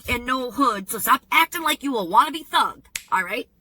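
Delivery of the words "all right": normal talking voice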